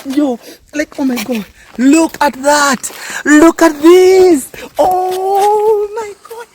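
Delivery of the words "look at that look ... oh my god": awed. Real, not acted. Marvelling at the view.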